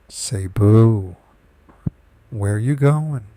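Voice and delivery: booming big bad wolf greeting; loud wolf voice. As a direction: call out to her like a hungry wolf. Big bad wolf accosts girl.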